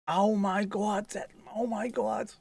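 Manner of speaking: high, slow, drawn-out New York squeal; mock New York gush. Retelling daughter spotting a sausage dog, high mock New York gush. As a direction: squeal it in a put-on New York accent without shouting. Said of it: "Jess just screamed it".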